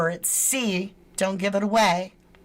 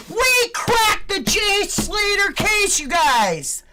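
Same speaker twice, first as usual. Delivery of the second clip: triumphant shout; 9 semitones higher, slower than usual. Real, not acted. Vlogger to viewers announcing the case cracked, triumphant shout. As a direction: proclaim it to the world.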